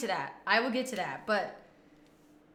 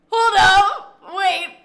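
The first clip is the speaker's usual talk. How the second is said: surprised; 7 semitones higher, 12 dB louder, much slower than usual. Real, not acted. Shocked reaction.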